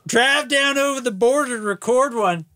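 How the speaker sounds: put-on Kentucky accent. Resumes the requested Kentucky accent.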